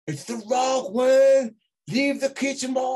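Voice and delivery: high, loud TV wrestler catchphrase; Rock catchphrase impression. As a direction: belt it out like a wrestler on TV.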